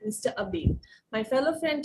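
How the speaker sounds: usual speaking voice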